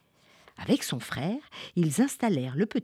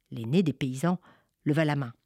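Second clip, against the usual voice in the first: ordinary delivery, nothing marked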